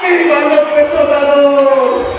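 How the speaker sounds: shouted vivas to the crowd; rallying cheer. Real, not acted. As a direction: belt out each viva.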